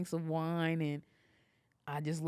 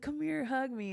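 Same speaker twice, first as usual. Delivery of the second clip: imitating excited partner; 10 semitones higher than usual. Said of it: "He's, like, so excited".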